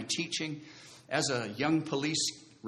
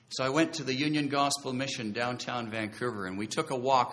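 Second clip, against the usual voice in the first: ordinary talk, nothing marked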